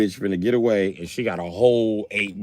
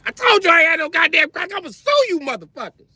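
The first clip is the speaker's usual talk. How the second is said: much higher, loud indignant yell; angry woman shout; 4 dB louder than usual. Retold or imitated. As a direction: threaten to sue at full volume. Prostitute yells at cops.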